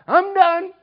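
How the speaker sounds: put-on high, loud, proud kid's shout; eager kid. Boy proudly telling his mom he's finished, high and loud. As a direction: sing it out like a kid done with chores.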